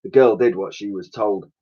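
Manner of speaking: ordinary delivery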